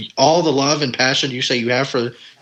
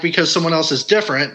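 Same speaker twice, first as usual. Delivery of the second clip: ordinary talk, nothing marked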